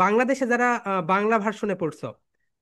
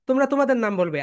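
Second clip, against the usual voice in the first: ordinary talk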